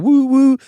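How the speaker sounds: high loud whistle woo woo; train whistle woo. Retold or imitated. Kid playing trains, loud high whistle. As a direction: hoot it like a toy train.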